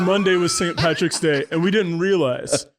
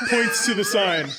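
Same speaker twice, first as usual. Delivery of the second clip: usual speaking voice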